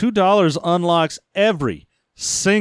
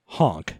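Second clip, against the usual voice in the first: much lower, quieter honk; low clown honk; 12 semitones lower, 6 dB quieter than usual. Pretend. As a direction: drop the honk way down and soft.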